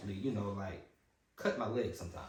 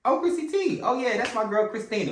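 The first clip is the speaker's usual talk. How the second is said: loud, very high-pitched exclamation; real excitement, voice leaping way up; delighted shout-out; 12 semitones higher, 9 dB louder than usual. Real, not acted. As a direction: burst out about a dear friend. Bursts out when asked about friend.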